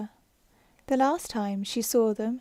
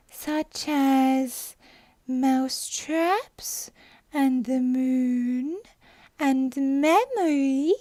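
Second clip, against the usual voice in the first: drowsy Dormouse; 3 semitones higher, 4 dB louder, much slower than usual. Acted. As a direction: dreamily list things, slowly. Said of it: "woke up again with a little shriek".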